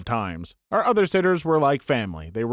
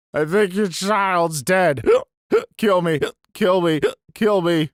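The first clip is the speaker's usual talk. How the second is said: put-on higher, drawn-out drunken confession with gasps; drunk babysitter; 4 semitones higher, 3 dB louder, slower than usual. Drunk babysitter phones a child's mom, drunken and gasping. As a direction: slur it out high, gasping between the pleas. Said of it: "She was drunk".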